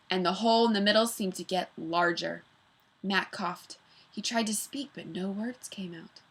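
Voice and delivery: usual speaking voice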